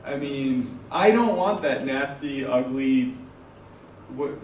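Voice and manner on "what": ordinary delivery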